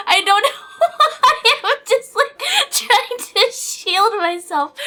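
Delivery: nervous laughter. Real, not acted. Streamer explaining why she shields herself, laughing nervously. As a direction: talk through a fit of nervous giggles.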